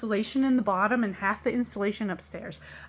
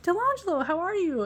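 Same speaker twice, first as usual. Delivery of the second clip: much higher, sing-song and friendly; cheery greeting; 9 semitones higher than usual. Retold or imitated. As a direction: greet him brightly like a fond stepmom. Greeting stepson in retold chat.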